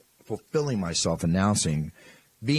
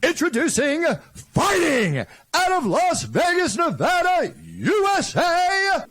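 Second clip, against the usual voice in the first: speeding up to reveal; 6 dB louder, slower than usual. Shown as a demonstration. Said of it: "you say what I tell you".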